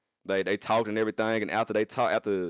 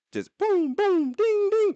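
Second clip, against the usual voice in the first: high, sing-song phone alert noises; imitated notification dings; 4 dB louder, slower than usual. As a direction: chime out the boom and ding like a phone going off. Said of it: "them goddamn fucking Apple notification sounds".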